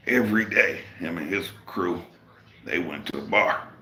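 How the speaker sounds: usual speaking voice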